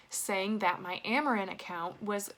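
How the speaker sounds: ordinary delivery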